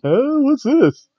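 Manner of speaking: put-on childlike bewildered voice; mock confused squeak. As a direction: play Nikki as utterly lost and surprised. Acting out confused Nikki.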